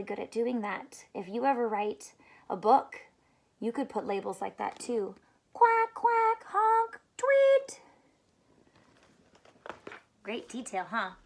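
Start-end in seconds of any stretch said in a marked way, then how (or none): 5.5-7.8 high quacks, honk and tweet; high sound effects. Shown as a demonstration. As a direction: voice each sound label. Rereading sound labels.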